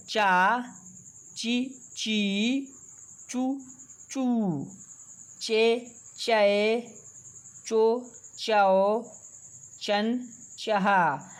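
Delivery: slow, deliberate recitation of each syllable; teacher reciting the barahkhadi chart one syllable at a time; syllable drill. Shown as a demonstration. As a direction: call out each sound clearly for the class to repeat.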